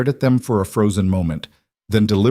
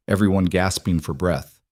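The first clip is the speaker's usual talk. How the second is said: usual speaking voice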